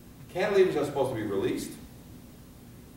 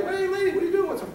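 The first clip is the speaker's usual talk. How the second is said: high, loud mock challenge; acted hailing call; 5 dB louder, faster than usual. Firemen arriving as a mother breaks in, calling out high and loud. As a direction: hail someone urgently, pitched way up.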